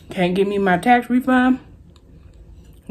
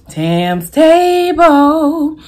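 announcer voice; 8 semitones higher, 5 dB louder, much slower than usual. Real, not acted. Drawn-out show name.

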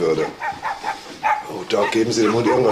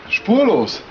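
sharp high echo of the word, louder; surprised echo; 8 semitones higher, 5 dB louder than usual. Acted. Inspector echoing the news of the disappearance, startled. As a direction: snap the word back in disbelief.

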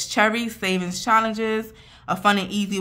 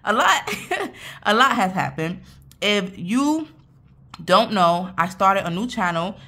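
normal talking voice

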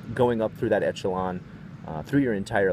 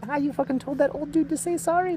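high excited mock teen voice; high school kids. Teen delinquents praising him, high and excited. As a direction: hype it up like an impressed teenager. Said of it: "were like".

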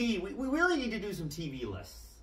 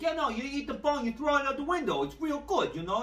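higher, playful mock Italian accent; lightly voiced mock Italian movie driver; 7 semitones higher than usual. Retelling the Green Book car scene, in a mock Italian accent. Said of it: "And he's doing the accent".

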